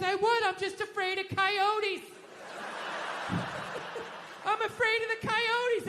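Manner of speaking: high, slow mock-timid wife; imitating wife. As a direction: play the wife's flimsy excuse, high and slow. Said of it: "She goes".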